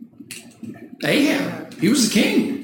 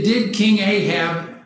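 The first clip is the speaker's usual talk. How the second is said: ordinary talk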